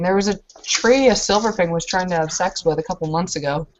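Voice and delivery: ordinary talk, nothing marked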